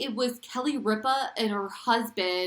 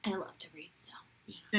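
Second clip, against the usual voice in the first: whisper; 9 dB quieter, faster than usual. Real, not acted. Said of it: "I know. whisper".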